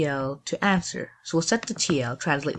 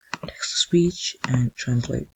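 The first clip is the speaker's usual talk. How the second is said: slow whispered reading of the block names; slower than usual. Real, not acted. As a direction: breathe the names out slowly, no voice. Reads block names while adding.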